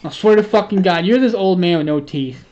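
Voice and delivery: loud teasing. Real, not acted. Opener loudly roasts friend as a toothless old man. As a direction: roast him loudly but playfully.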